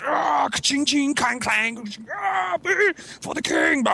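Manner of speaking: high-pitched clashing swords and war cries; mock battle cries. Imagining the great battle on the field, mock war cries. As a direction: act out a battle with your voice.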